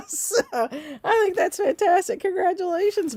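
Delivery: high, gushing laughing delight; delighted congratulations. Real, not acted. Host congratulating a first-time winner, delighted.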